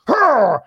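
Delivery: high mock yank grunt; effort grunt. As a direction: grunt as if yanking hard. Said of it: "and goes".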